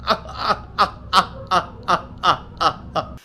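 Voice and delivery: breathy unvoiced wheezing laugh; real laugh demo. Shown as a demonstration. Showing chat how he truly laughs, breathy wheeze. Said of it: "here's how I laugh".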